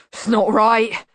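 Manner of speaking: grumbled monster complaint, loud; mild mumbled protest; mumbling. A narrator's reading. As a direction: grumble it like a wronged bogeyman. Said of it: "it mumbled".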